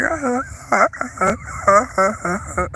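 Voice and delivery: broken "ah" croaks starting high; lost-voice imitation. Vlogger recalling her voice days ago, imitated broken croaking. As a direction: imitate a voice cutting in and out. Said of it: "that's what I sounded like".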